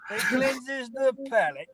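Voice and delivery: high, slow, drawn-out mimicry; mimicked catchphrase. Echoing the host's catchphrase back at him, playful mimicry.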